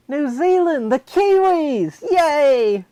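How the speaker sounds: high, loud hammed-up cheering; drawn-out mock-jubilant shout; excited cheer. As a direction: whoop it up in a German accent. Cheering in the German character.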